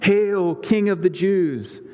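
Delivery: high, drawn-out mock acclamation. A narrator's reading. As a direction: hail him in a high voice, in mockery. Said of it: "bowed before him and mocked him".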